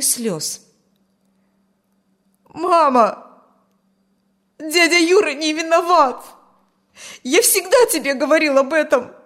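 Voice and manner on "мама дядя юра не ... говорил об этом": high, loud outcry to mother; impassioned protest. A narrator's reading. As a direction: plead his innocence at full pitch. Son in tears protests to mother. (пылко, почти криком)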